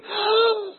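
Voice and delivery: sharp, very high gasp of mock shock. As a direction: gasp like a parent caught off guard. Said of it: "and then you go".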